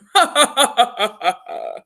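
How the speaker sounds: villainous dinosaur laugh; big evil laugh. A narrator's reading. Other dinosaur laughing at the plan, evil laugh. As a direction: let out a big evil cackle. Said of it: "sounded like a big evil laugh".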